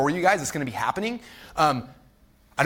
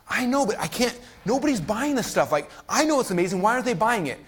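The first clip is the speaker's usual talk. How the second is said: higher-pitched retold frustration; exasperated protest; 7 semitones higher than usual. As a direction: plead your case in mock exasperation.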